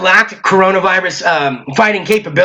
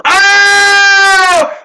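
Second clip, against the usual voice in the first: loud high "Oh!"; surprised shout; 11 semitones higher, 5 dB louder than usual. Acted. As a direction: burst out in surprise. Reacts to wife's answer.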